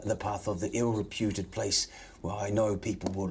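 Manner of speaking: ordinary delivery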